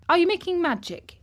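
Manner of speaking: high and quick; eager, pointed question; sharply. Acted. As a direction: snap the question out fast and high. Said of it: "he asked sharply".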